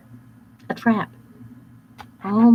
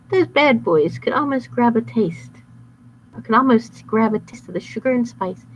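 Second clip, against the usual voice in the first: usual speaking voice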